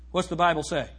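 normal talking voice

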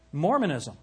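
ordinary talk

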